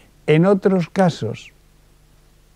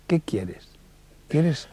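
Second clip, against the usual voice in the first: whisper; 7 dB quieter, much slower than usual. Shown as a demonstration. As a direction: whisper the question to yourself. Whispering the question.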